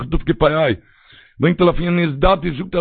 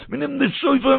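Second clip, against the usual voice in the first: ordinary talk, nothing marked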